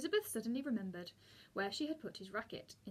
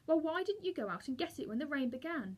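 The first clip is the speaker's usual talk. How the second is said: higher-pitched indignant question; furious demand; 5 semitones higher, 4 dB louder than usual. A narrator's reading. Boy blaming Elizabeth for the wet racket, furious. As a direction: throw the question at her, cross and high. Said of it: "said Patrick furiously".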